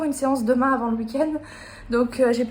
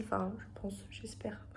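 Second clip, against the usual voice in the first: soft whispered aside; 3 semitones lower, 17 dB quieter than usual. Real, not acted. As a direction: whisper it to yourself. Doubtful afterthought, said aside.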